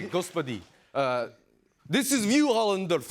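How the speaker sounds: put-on Russian accent, rising to a shout; in-character Russian accent, getting loud. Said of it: "can you do a Russian accent?".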